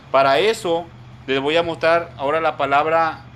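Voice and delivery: normal talking voice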